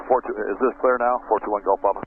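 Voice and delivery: ordinary talk, nothing marked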